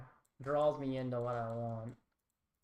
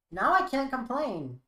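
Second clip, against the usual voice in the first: excited; 8 semitones higher, 7 dB louder than usual. Real, not acted. Good draw during match.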